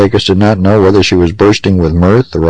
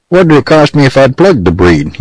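higher-pitched sarcastic query; 7 semitones higher than usual. A narrator's reading. Pecos asks the judge about the fine, sarcastically. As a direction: needle the judge with a mock question. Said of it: "inquired Pecos sarcastically".

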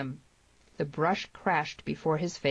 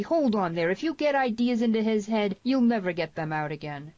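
raised, higher protesting voice; shout; 6 semitones higher, 4 dB louder than usual. A narrator's reading. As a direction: shout a warning to stop her. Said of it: "shouted Harmony".

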